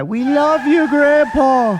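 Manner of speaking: loud, high call from a passing car; shouting. Retold or imitated. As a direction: yell it out across the street. Cars shouting greetings to grandpa.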